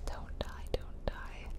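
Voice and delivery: ordinary delivery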